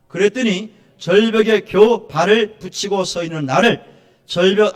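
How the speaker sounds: deliberate, projected reading in phrases; declamatory reading. Real, not acted. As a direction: read it out for the congregation to follow. Reads a text aloud to congregation.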